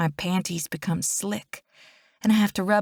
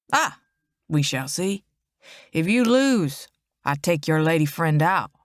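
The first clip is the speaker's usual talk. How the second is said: put-on Cajun accent. A narrator's reading. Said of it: "a strong Cajun accent".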